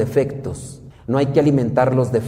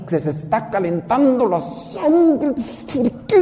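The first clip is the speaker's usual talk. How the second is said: high, slow whining complaint; mock impatient whine; 11 semitones higher, slower than usual. Preacher mimics an impatient believer, high whining. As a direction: whimper like someone losing patience.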